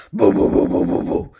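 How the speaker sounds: loud, deep, wordless booming mumble; low imitated "boo boo boo" rumble; gruffest man's voice. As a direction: imitate a gruff man rumbling through a wall. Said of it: "I'm talking the gruffest man's voice".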